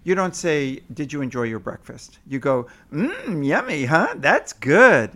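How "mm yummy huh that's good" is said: put-on high, lilting baby talk to a toddler; sing-songy parentese. Shown as a demonstration. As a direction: coo it like talking to an infant. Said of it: "this sing-songy thing".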